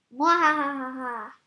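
slow villainous mwahaha; mad scientist laugh. Acted. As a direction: cackle over the evil plan. Villain cat ends gloating speech.

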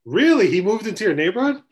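high, loud, amazed "Really?"; genuinely taken aback by the news; surprised. Real, not acted. Friend hears surprising celebrity news.

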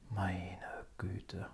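exasperated whisper. Acted. Vincent annoyed at Timo's message, whispering to himself. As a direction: breathe it out under your breath. Vincent groans alone at phone.

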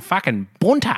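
높고 큰 호주 억양으로 "Fucking bunta"; 호주 억양으로 "bunta"를 툭 내뱉기; Australian accent. Retold or imitated. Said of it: "with the Australian accent going".

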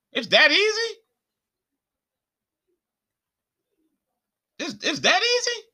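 high-pitched disbelief; incredulous questioning. Real, not acted. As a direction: ask it sky-high, unable to believe it. Disbelief at her easy claim.

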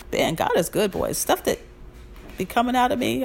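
ordinary talk

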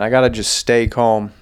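normal talking voice

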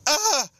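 sudden shrill high yelp; imitated scream. Friend going down on a foul, high yelp. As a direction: recreate the fall scream. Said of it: "this noise that I cannot recreate".

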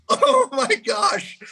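very high, drawn-out squeak; overwhelmed. Retold or imitated. Drummer stunned by the tour offer, overwhelmed squeal. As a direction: squeal it slowly in disbelief. Retelling his shock at the offer.

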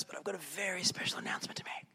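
fast, whispered aside; hushed visitor. Pretend. Visitor whispering his news to the church. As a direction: breathe it like a secret announcement.

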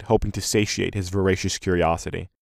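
plain reading voice

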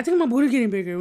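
mocking impression. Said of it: "You're like".

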